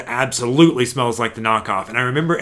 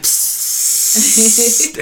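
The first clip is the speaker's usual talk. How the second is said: spray can hiss; 12 semitones higher, 5 dB louder than usual. Retold or imitated. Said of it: "the three dollar aerosol".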